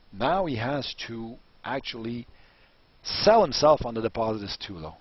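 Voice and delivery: ordinary talk, nothing marked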